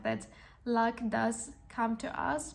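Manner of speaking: usual speaking voice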